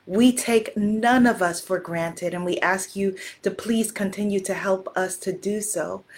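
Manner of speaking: soft, measured prayer of thanks; hushed, reverent group blessing. Real, not acted. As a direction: pray aloud gently, phrase by phrase.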